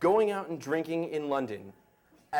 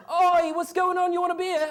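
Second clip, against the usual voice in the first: much higher, loud English barman shout; imitating English bartender; 14 semitones higher, 7 dB louder than usual. English bartender greets the American tourist, loud mock shout. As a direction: bellow it like a cheery London barman.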